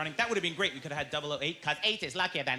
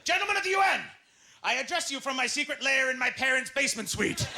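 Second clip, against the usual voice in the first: broke villain; 6 semitones higher, 4 dB louder than usual. Acted. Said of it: "broke-ass James Bond villain who's like".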